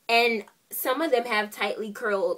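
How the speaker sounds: ordinary talk, nothing marked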